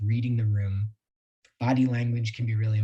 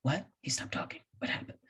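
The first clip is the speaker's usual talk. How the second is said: confused whisper; 3 semitones higher, 10 dB quieter than usual. Retold or imitated. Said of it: "people are like".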